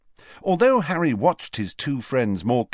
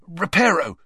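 quick, louder higher incantation; hasty spell; 8 semitones higher, 4 dB louder than usual. A narrator's reading. Harry hastily casting a spell, quick and raised. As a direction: fix it fast before anyone notices.

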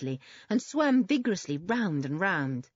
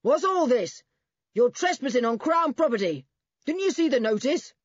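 loud, higher-pitched angry challenge; 6 semitones higher, 6 dB louder than usual. A narrator's reading. Man catching trespassers across the pool, shouting. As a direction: bellow it across the water. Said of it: "A very loud voice".

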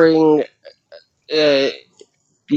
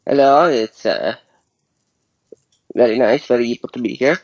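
lightly voiced mock English accent; a hint of a put-on English accent; fake English accent; 5 semitones lower, much faster than usual. Co-host greeting the show in a mild mock English accent. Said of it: "you're supposed to be the American, not the Englishman".

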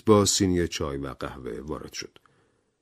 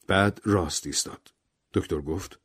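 ordinary delivery

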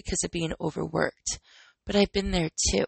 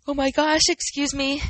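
mid-yawn; 6 semitones higher, 4 dB louder than usual. Real, not acted. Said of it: "getting a yawn in".

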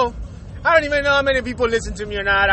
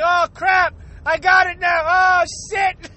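mock panic; 6 semitones higher, slower than usual. Panicked reaction to sneeze.

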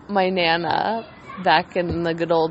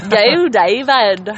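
hammed-up Scottish accent, drawn out and louder; bad Scottish accent; 2 semitones higher, 5 dB louder, much slower than usual. Imitating Nana cheering on David, bad Scottish accent. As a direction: stretch the vowels in a botched Scottish accent. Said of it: "I can't do a Scottish accent".

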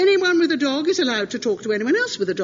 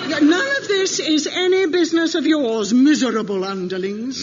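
loud, menacing mock tyrant voice; dragon voice; 2 semitones higher, 6 dB louder than usual. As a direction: scorn the underlings like a dragon. Mock menacing scolding of crew.

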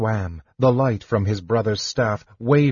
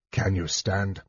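quiet, low harshness; understated harsh question; 3 semitones lower, 6 dB quieter than usual. A narrator's reading. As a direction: ask it curtly without raising the voice. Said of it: "he asked harshly".

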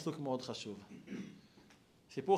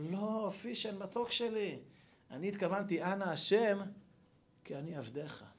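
tender grandfather; 3 semitones higher, 7 dB quieter than usual. Acted. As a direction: gently set the little one straight. Grandfather gently correcting grandson. (סבא רך ואיטי מתקן את הנכד באהבה)

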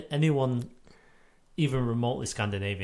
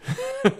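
high laugh; 5 dB louder than usual. Real, not acted. Burst of laughter.

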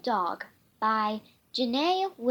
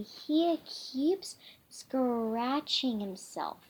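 slow hushed whisper; slower than usual. Acted. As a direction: whisper it like a secret. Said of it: "they whispered".

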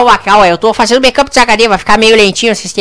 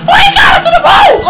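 very high shrill shriek of a greeting; shrieking hysteric. Acted. Hysterical character shrieks hello to the host.

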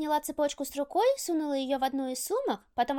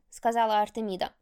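usual speaking voice

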